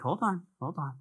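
a calm hint of God saying wait; God reassuring. God answering a frustrated believer, soft reassuring voice. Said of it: "And God's like".

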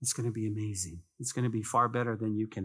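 a calm hint of God saying wait; God reassuring. God answering a frustrated believer, soft reassuring voice. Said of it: "And God's like".

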